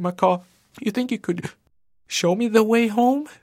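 put-on young boy voice. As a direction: play a lost, scared child. Lost boy pleads with macaw.

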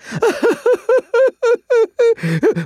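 high, quavering sobbing gasps; long pitiful whimper; frightened whimpering. Acted. Chipmunk pinned by Bear, whimpering in fear.

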